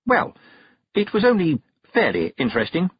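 high, breezy brush-off; unembarrassed. A narrator's reading. Witch caught snooping, completely unembarrassed. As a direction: dismiss it without any shame. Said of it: "completely unembarrassed".

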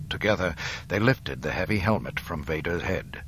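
usual speaking voice

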